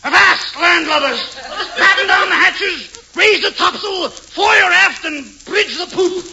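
booming hammy sea captain bellowing orders. Pretend. Man in captain's suit shouting at imaginary crew, hammy and loud. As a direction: roar like a pirate captain on deck. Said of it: "makes me feel like a sea captain".

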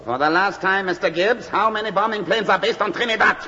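last warning from the interrogator; impatient demand. Acted. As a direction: demand it, voice rising high. Interrogator losing patience.

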